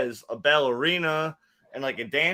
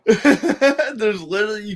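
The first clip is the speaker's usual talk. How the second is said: laughing; 6 semitones higher, 7 dB louder than usual. Real, not acted. Laughing through the complaint.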